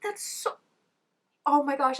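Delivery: cringing squeal; pained cringe. Real, not acted. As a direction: beg it to stop while wincing. Cringing at a scene.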